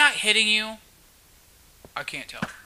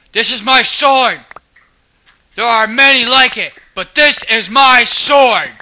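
loud, higher declamation; mock battle cry; 6 semitones higher, 11 dB louder than usual. Gamer reciting a warrior's creed over a sword, mock battle cry. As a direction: proclaim it at the top of your voice.

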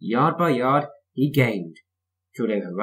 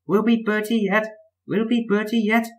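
high echo; 11 semitones higher, 3 dB louder than usual. A narrator's reading. Annie and Clarabel repeating the driver's words, high echo. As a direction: echo in much higher coach voices. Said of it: "echoed Annie and Clarabel".

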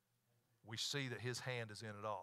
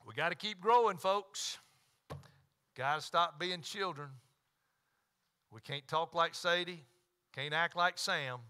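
raised, higher preacher's call; loud exhortation; 6 semitones higher, 10 dB louder, slower than usual. Real, not acted. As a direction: exhort the congregation loudly, teasing them. Preacher exhorts, teasing congregation.

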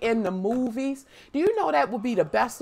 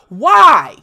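loud why; 10 dB louder than usual. Retold or imitated. Kid protesting dad's long sleeves rule, shouting. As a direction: yell it in disbelief. Kids questioning dad's order.

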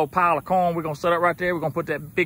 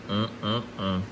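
ordinary talk, nothing marked

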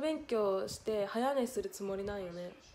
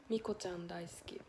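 soft whisper, much softer; whispered confession; 5 semitones lower, 8 dB quieter than usual. Real, not acted. Streamer confessing her favourite to chat, whispered. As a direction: confide it under your breath.

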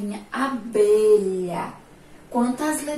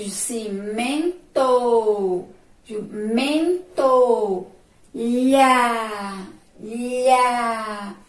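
loud, slow stress on the rhyming endings "co", "ia" and "bra"; stressing rhyme endings; 4 dB louder, much slower than usual. Shown as a demonstration. Teacher shows the class which sounds rhyme, stressing each ending loudly. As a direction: hammer each shared ending so the rhyme rings. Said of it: "Elas terminaram emitindo o mesmo som".

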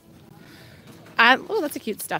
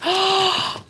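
sharp excited intake of breath; a real little gasp of delight; 8 semitones higher than usual. Real, not acted. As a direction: gasp at something cute. Spots cute roller skates.

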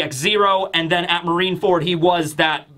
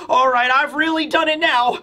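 flustered Vegapunk; 7 semitones higher than usual. Retold or imitated. Scientist panicking to Quasar about leaked memories, higher flustered voice. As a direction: fret breathlessly like a panicked inventor. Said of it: "he looks out of breath".

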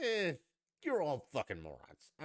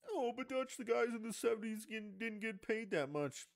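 mocking whiny objector; 12 semitones higher than usual. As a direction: squeak out the critic's complaint like a pushover. Mocking imagined critic again.

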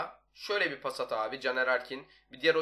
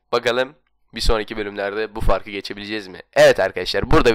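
goal scream; 13 dB louder than usual. Real, not acted. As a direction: belt it like a stadium announcer. Huge goal shout then loud outro.

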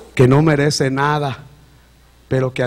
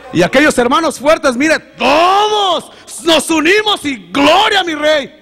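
high, loud, fervent testimony; rising, loud pulpit voice; preaching at full force; 12 semitones higher, 7 dB louder, slower than usual. Acted. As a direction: preach it out to the congregation. Shouted glory in preaching.

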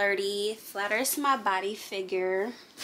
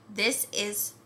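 ordinary talk, nothing marked

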